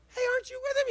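put-on a small girl accusing Peter in the courtyard, high girl's voice; little girl. Pipe it up high like a little girl. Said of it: "One's a little girl".

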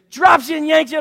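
worked-up, yelling storytelling; shouted ride retelling. Retold or imitated. Reenacts the sudden plunge.

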